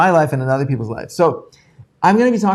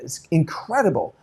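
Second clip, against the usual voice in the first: ordinary talk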